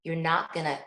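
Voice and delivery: ordinary delivery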